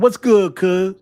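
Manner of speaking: a voice shouting from the back; imitating background voice. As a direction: echo the guy in the background, loud and high. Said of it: "Is what it sounds like".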